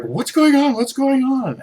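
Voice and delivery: imitating Mom. Mother demanding answers from her bleeding child, high and worried. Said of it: "she's like".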